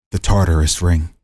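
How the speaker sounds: ordinary delivery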